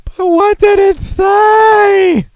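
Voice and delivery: high, loud, very slow hammed-up question; teasing a co-host with a drawn-out mock shout. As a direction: stretch it out in a big mock bellow. Mock-dramatic question to Mitch.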